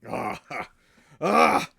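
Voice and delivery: two harsh roars of shock; orc's pained cry. Acted. GM as an orc reacting to his fallen friend, pained cry. Orc character cries out before speaking.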